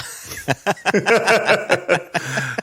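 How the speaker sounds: real hearty laugh; amused belly laugh. Real, not acted. Laughs at the exchange.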